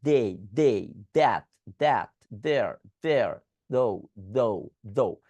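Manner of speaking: "they", "that", "there", "though" each with a voiced th, tongue between the teeth; th drill. Shown as a demonstration. Teacher drilling students on voiced th words. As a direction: repeat each word slowly with the tongue out. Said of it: "এটাকে বলবো they".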